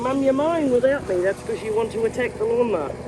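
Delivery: high, loud voicing of an indignant dog; dog's complaint. Owner voices her dog at the bedroom window, loud complaint. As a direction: call out as the pet tattling on mum. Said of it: "Baya's in the bedroom window going".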